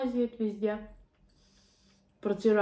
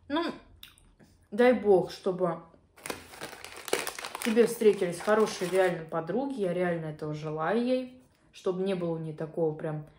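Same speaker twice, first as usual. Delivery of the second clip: chewing while talking; eating fried chicken; mouth full. Real, not acted. Seen 3: Food bitten at -5; lips pursed, cheeks working through labelled words.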